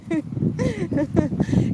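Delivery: a burst of high laughter at the memory; high real laugh. Real, not acted. Streamer laughing to viewers at the biker's sweet words, high laugh. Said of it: "It was really funny".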